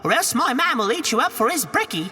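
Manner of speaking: put-on high Cockney woman shooing the boy; a high, brash Cockney housewife; Cockney ogre's wife. Wife shoos Jack from the castle door, high Cockney.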